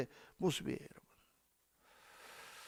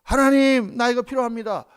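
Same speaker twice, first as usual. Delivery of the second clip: louder, higher earnest plea to God; fervent prayer; 3 semitones higher, 7 dB louder than usual. Shown as a demonstration. Pastor showing the church a simple inner prayer, fervent plea. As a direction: pray it out with full heart. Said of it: "내가 내면적으로".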